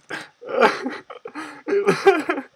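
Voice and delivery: high-pitched giddy giggle; high giggling laugh. Real, not acted. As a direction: break into a long squeaky laugh. Laughing at troll result.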